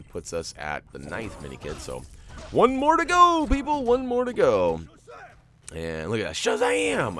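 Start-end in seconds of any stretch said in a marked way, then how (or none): 2.5-4.8 high, loud call to the crowd; rallying shout. Real, not acted. Rallying viewers near the goal. 6.3-7.2 high, loud imitation of a hero's cry; mock hero yell. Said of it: "he's yelling his own name out".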